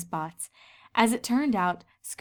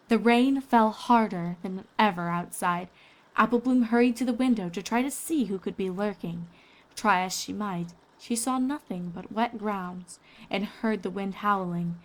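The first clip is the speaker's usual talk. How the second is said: ordinary delivery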